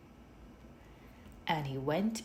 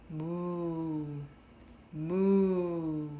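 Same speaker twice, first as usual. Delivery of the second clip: cow moo. A narrator's reading. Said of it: "The cow said".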